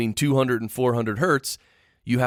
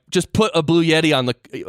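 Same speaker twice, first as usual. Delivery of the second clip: ordinary talk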